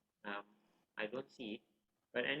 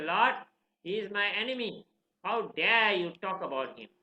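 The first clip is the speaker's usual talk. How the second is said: raised, forceful mock reproach; indignant father; 4 semitones higher, 6 dB louder than usual. Father confronting his son about his enemy, indignant. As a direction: punch it as an acted scolding.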